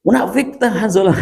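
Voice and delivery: high-pitched quoted exclamation; high intonation. Retold or imitated. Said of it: "intonasinya tinggi".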